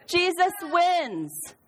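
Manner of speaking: high, slow, sweet Sunday school praise. Shown as a demonstration. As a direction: stretch Jesus wins out for little kids. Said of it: "In your best Sunday school voice".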